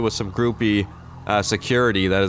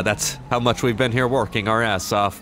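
ordinary delivery

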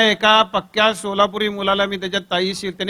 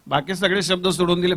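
ordinary talk

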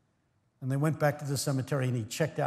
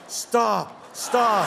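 louder, higher mock plea to stop; mock yell; 6 semitones higher, 6 dB louder than usual. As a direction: beg the preacher to stop. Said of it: "They yell".

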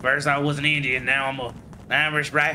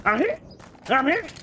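much higher, hammed-up cry for a medic; mock panicked cry; 4 dB quieter than usual. Gamer hamming up his hit soldier calling for a medic, panicked. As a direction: play the wounded soldier yelling for help.

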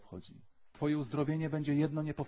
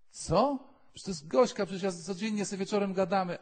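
much higher, loud disbelieving protest; indignant girl; 8 semitones higher, 8 dB louder than usual. Retold or imitated. A girl defends her friend Gośka to God, high and indignant. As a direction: protest like a stunned teenager.

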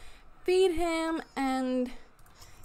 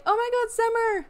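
alarmed cry; 8 semitones higher, 4 dB louder than usual. Real, not acted. Sim freezing on rink.

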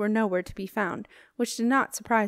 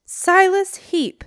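slow, drawn-out call, much higher and louder; ringing shout; 10 semitones higher, 10 dB louder, much slower than usual. A narrator's reading. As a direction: call his name across a huge hall. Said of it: "Marcia's voice carried across the great hall".

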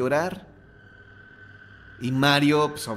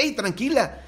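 high startled yelp, much higher; 12 semitones higher than usual. Retold or imitated. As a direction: jump in alarm and try to calm her. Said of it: "se espanta y dice".

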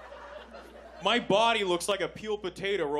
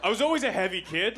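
ordinary delivery

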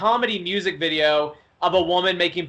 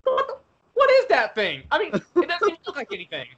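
Chef; 10 semitones higher than usual. Retold or imitated. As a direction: imitate a startled cartoon man, pitched way up. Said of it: "when Chef first saw that, he just goes".

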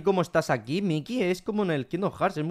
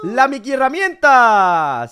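slow, loud and much higher, like a cartoon host; Mickey-style announcement; 4 semitones higher, 8 dB louder, much slower than usual. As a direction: announce it like a kids' show reveal. Repite el anuncio como Mickey. (anunciando la Mickey herramienta muy alto y lento)

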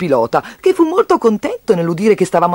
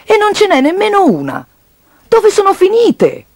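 indignant, pitched-up questioning; indignant demand; 11 semitones higher, 4 dB louder than usual. Acted. Narrator asks piggy bank about missing coins, indignant.

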